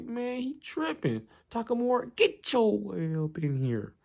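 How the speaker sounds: high, slow put-on coach voice calling across the gym. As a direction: summon Takamura in a drawn-out high voice. Coach calling Takamura over.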